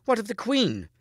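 high, loud challenging question; pointed demand. A narrator's reading. Mordred challenging the king about the queen, pointed. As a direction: press the question sharply, pitched high.

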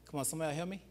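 ordinary delivery

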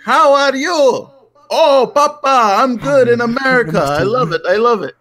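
put-on high, slow Greek-accented uncle; imitating a Greek farmer uncle, slow and high; mock Greek accent of the old uncle; uncle's Greek accent. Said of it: "He's like".

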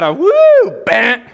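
high, loud woo then punchy bam; imitated whoop and bam. Preacher imitating a reaction to saved, loud whoop.

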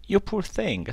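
tender pity for the bird; farmer voice. Acted. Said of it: "said the farmer".

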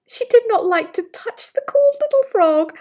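ordinary delivery

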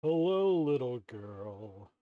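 low, slow menacing wolf voice; big bad wolf. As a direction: drawl it out like a wolf luring prey. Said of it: "it's me, the big bad wolf".

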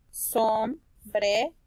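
sombrero slowly as som, bre, ro; split into syllables. Shown as a demonstration. Teacher reading by syllables, sombrero slow and split. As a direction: break sombrero into three with pauses.

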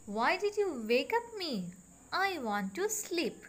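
sleepy queen complaining. Acted. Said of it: "opened her eyes and said".

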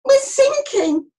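high, loud, stretched wail; panicked cry. A narrator's reading. Sailor on a sinking boat, panicked cry. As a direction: cry out in alarm, drawing it out. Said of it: "cried a sailor".

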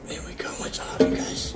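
hushed, breathless whisper. Real, not acted. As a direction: whisper it in relief. Turbo finally comes off.

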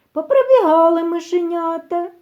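usual speaking voice

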